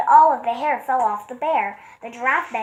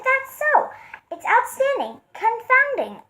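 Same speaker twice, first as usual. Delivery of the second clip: delighted; 13 semitones higher, 3 dB louder than usual. Acted. Elephant delighted.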